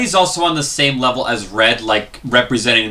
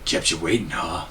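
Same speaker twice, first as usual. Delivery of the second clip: low, quiet gravel-free growl of Snake; Solid Snake impression; 6 semitones lower, 10 dB quieter than usual. Shown as a demonstration. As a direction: drop low and quiet like a stealth soldier. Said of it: "See, he does it".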